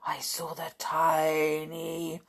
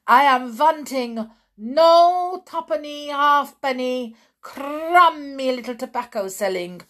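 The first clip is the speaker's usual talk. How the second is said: put-on loud, higher foreign-accented witch shout; Grand High Witch shouting; 5 semitones higher, 8 dB louder than usual. A narrator's reading. Grand High Witch ordering her witches, loud foreign-accented shout. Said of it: "shouted the Grand High Witch".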